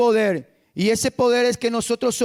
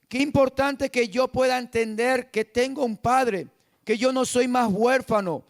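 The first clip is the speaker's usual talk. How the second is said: usual speaking voice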